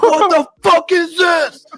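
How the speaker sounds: very high, loud, drawn-out yell; imitated screaming. Drill instructor in a recruit's face, imitated screaming. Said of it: "just, like, screaming, dude, just screaming".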